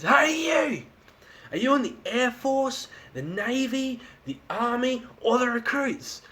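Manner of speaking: high-pitched call to a stranger. Retold or imitated. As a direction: shout it high across to the other island. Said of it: "He goes".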